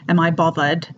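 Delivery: "bothered" pronounced bovvered. Shown as a demonstration. Teacher recalling an earlier example, "bothered" with a V for TH. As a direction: say "bothered" with a Cockney V. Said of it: "is the V".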